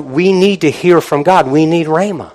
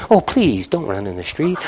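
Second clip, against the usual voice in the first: timid pleading; 2 semitones lower, 8 dB quieter than usual. Retold or imitated. Parent too meek to stop the child, soft pleading. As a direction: beg the child weakly.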